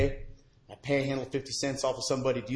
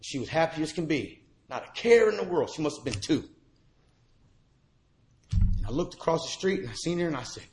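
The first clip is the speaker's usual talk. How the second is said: ordinary delivery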